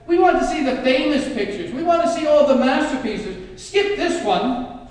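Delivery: loud, high heckle from the back; impatient bidder shouting from the back; shouted demand. Acted. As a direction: yell at the auctioneer to skip it. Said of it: "who shouted".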